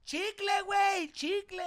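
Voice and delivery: high slow mock shout; drunk girl shouting. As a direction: bellow it like a drunk fan. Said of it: "the girl's like".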